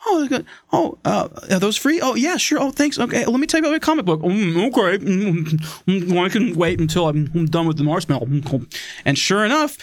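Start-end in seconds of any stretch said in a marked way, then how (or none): 0.1-4.2 high-pitched eager imitation; acted sales exchange. Acting out shoppers and eager seller. 4.2-8.6 mumbling through a pretend mouthful; mouth full. Customer answering while eating a marshmallow.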